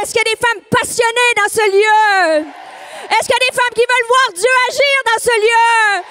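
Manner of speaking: loud, rousing preacher calls to the crowd; full-force preaching. Acted. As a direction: whip up the room like a revival preacher. Prédication à pleine voix. (prédication enflammée et criée)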